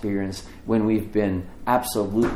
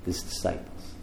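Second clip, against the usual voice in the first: a hushed reveal; whispered answer; 5 semitones lower, 10 dB quieter than usual. Real, not acted. Answering own question, hushed.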